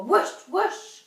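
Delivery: high, loud breathy whoosh sounds; dramatic whoosh noises; loud whooshing puffs. A narrator's reading. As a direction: blow out the puffs like a beast. Imitating the smoke puffs.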